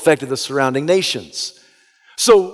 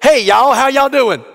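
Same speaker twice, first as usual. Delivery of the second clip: mock Southern greeting; 9 semitones higher, 5 dB louder than usual. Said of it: "I was so tempted to say".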